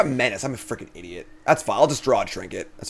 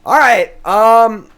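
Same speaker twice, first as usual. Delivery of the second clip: excited; 10 semitones higher, 11 dB louder, much slower than usual. Real, not acted. Excited streamer.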